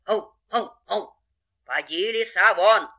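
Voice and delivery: barks building to a loud order; bark, then loudly order the fox out; barking dogs. Acted. Dogs chase the fox away, barking. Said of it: "Собаки забрехали".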